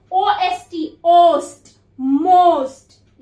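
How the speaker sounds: most drawn out slowly, each sound held; stretched-out phonics demo. Shown as a demonstration. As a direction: stretch ओस्ट then most out slowly for the class. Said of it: "जैसे word है most".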